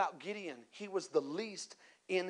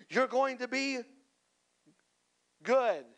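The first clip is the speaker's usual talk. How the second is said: mock prophet; 5 semitones higher, 3 dB louder than usual. Giving someone a prophetic word at church, mock prophetic. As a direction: proclaim it grandly, with a long pause before the last word. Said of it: "prophetic moment, and they would say".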